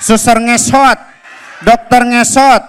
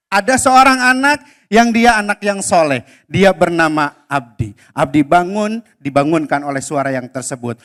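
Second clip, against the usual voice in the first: story narration; 7 semitones lower, 5 dB quieter than usual. A narrator's reading. Storyteller to a room of kids, calm narration. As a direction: tell it calmly to the children.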